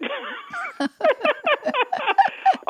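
high, sustained laugh; real laugh. Real, not acted. Caller amused at dusting off her old book, real high laugh. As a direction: laugh long and high at yourself.

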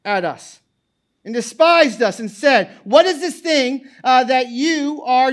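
loud, high preaching at full force; fiery scripture reading. Acted. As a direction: proclaim the passage to the congregation.

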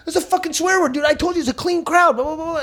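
high, whiny ranting club owner; mock angry owner. Club owner scolding the comic, high whiny rant. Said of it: "And the guy goes".

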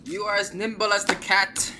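high, loud hammed-up laugh into speech; laughing dwarf. Dwarf turns to tease the party, laughing dwarf voice. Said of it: "The dwarf turns around and laughs".

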